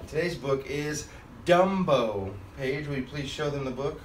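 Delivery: ordinary delivery, nothing marked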